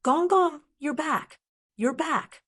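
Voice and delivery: shouting. A narrator's reading. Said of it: "she shouted".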